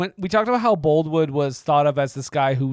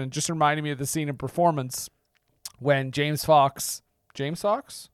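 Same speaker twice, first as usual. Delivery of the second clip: ordinary talk, nothing marked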